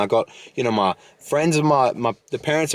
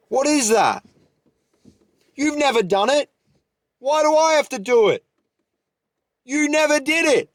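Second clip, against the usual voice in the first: yelling fired up; 10 semitones higher than usual. Real, not acted. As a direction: rant at the know-it-all advisers. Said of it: "they're looking at me like I'm yelling".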